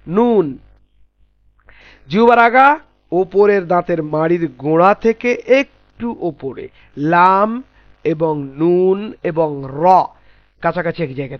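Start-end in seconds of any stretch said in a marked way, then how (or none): none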